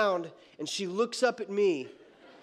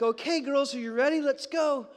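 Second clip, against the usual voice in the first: put-on excited dad rallying the girls; 6 semitones higher than usual. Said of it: "I'm like".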